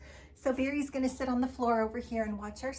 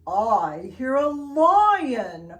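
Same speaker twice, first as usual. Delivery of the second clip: loud, slow, roaring lion voice; 3 semitones higher, 11 dB louder, much slower than usual. Acted. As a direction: growl the words like a big lion. Reading the lion page.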